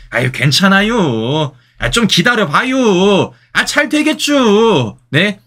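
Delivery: unhurried Chungcheong local telling you to just wait; Chungcheong dialect. Retold or imitated. Commentator imitating patient Chungcheong locals, slow Chungcheong drawl. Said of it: "제가 사투리를 참 못합니다".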